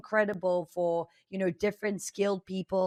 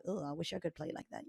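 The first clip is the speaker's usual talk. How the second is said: wistful hushed aside; 2 semitones lower, 11 dB quieter than usual. Retold or imitated. Viewer wishing they played better.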